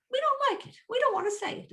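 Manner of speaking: very high, loud mock-protest voice; whiny Romans' refusal. Teacher voicing the Romans who dropped the A, high mock protest. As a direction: squeal it like stubborn objectors. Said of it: "They just said".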